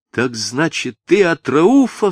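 eager high-pitched outburst; excited cry. A narrator's reading. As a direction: cry out the sudden realisation. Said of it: "вскричала Софичка".

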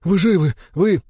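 falter, unable to believe it; overwhelmed disbelief. A narrator's reading. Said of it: "пробормотал он". (потрясённо, обрываясь)

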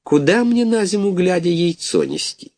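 a worried goose grumbling to herself; mild gloomy fretting; glum mother goose. A narrator's reading. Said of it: "уныло думает".

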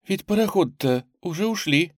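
high, anxious news-breaking; alarmed. A narrator's reading. Headmistress telling the girl about the steamers, alarmed. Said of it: "встревоженно прервала её".

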